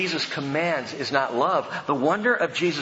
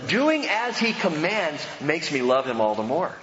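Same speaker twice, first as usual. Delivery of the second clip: ordinary talk, nothing marked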